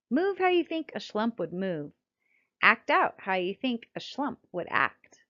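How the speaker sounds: usual speaking voice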